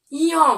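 ইয় said firmly as "iy", not "ing"; correct pronunciation. Shown as a demonstration. Teacher shows pupils the right sound of ইয়, firm and clear. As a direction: stress the letter ইয় clearly as "iy". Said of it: "ইঙ নয়".

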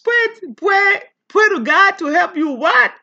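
high, loud, incredulous retort; mock indignant Moses. Preacher as Moses brushing off the pleading people, mock outrage. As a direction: play Moses refusing, half-joking and outraged. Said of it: "I would say".

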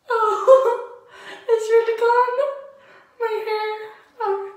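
high, loud half-laughing, half-crying; laughing shock. Real, not acted. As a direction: laugh in disbelief at the loss. Hair just cut off.

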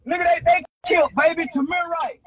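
loud outraged yell; furious loud shout. Real, not acted. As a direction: shout it out in disbelief. Outraged rant about a killed child.

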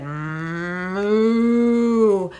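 long, swelling moo; cow moo. A narrator's reading. Cow joining in to wake the farmer, mooing. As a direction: build a loud, drawn-out moo. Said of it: "When the cow said".

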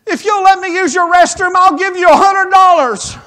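high, loud, near-crying plea from a stranger; frantic woman begging to use the restroom; desperate lady. Retold or imitated. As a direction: beg like she can't hold it another second. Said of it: "and the lady goes".

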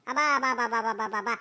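sarcastic mock. One grandma scoffs at the other, low scornful mock. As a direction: sneer it down at her. Mocking retort in quarrel.

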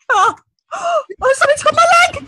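high, loud, fast panicked cry; terrified cry. Acted. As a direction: scream as something grabs you. Actor grabbed by a ghost.

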